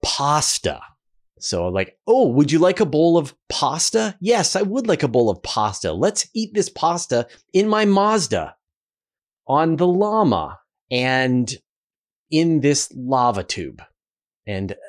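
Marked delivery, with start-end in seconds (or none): 0.0-0.8 pasta with a broad ah; "Pasta" as pahsta, open ah like an American. Shown as a demonstration. Canadian host demos the American way, broad ah. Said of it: "So an American would say". 2.1-13.8 playful American voice, pahsta and lahma; hammed-up American with a broad ah in "pasta"; mock American accent. Shown as a demonstration. Host mimics Americans ordering pasta, mock American accent. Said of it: "So an American would say".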